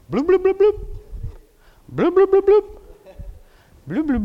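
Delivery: bloop bloop sung out high and loud; high dunking noises. Acted. Preacher acting out Naaman's dips in the river with loud, high bloops. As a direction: play the splash sounds big and high.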